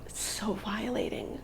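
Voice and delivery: slow, soft and hurt; hushed and upset. Real, not acted. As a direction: let it sink in quietly. Recalling the assault.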